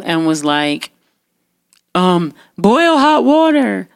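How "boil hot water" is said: higher, slow, drawn-out order; mock a roommate's silly urgent command; mimicked panicky roommate.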